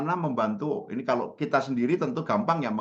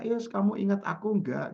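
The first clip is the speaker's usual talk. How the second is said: high, slow, lightly mimicked caller; a lighter take on the over-familiar caller; imitated caller's question; 7 semitones higher than usual. Caller asks without any greeting, high drawn-out imitation. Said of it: "langsung nanya".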